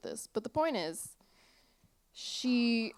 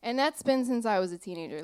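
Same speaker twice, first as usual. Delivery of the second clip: ordinary talk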